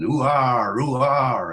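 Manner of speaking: mock pirate cry with a heavy R; pirate impression. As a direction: West Country pirate growing on each "ah". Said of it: "That's pirates".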